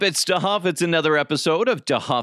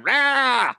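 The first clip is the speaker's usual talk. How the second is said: mock roar; 10 semitones higher than usual. Mock growl outburst.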